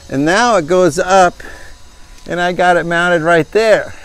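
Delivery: ordinary talk, nothing marked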